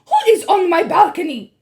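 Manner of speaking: ordinary delivery, nothing marked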